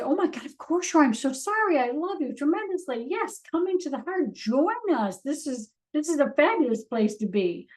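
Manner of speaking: high, warm rush of apology and welcome; loving excitement. Retold or imitated. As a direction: pour out love to the part of yourself. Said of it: "And I would say".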